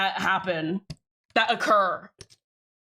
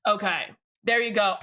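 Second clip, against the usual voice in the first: ordinary talk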